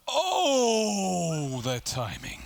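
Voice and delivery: long, loud, high "oh" sliding down; drawn-out commentator cry of disbelief; amazed cry. Real, not acted. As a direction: wail in amazement at the replay. Reacting to replay of timing.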